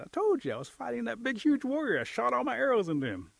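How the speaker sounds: high, loud, worked-up retort; exasperated boasting. Acted. Turtle tells turkey about his battle, exasperated boasting.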